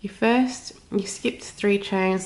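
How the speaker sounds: usual speaking voice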